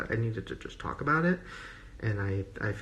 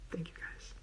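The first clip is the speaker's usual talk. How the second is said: soft whisper to herself; 18 dB quieter, faster than usual. Real, not acted. Creator whispering to herself while ending the video, whisper. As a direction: whisper it off-mic, unsure.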